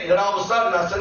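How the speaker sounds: normal talking voice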